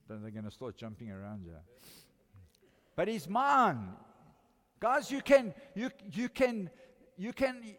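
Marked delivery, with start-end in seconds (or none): 2.9-7.7 loud, slow proclaiming that he's mine; preaching at full force. Acted. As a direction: drive it home to the congregation.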